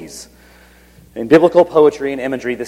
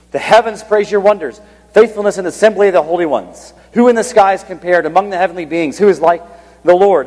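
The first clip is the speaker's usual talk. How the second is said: raised, declaiming psalm quote; scripture reading; 5 semitones higher, 3 dB louder than usual. Acted. Preacher quotes the heavenly court verses, declaiming. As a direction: lift the verses up like praise.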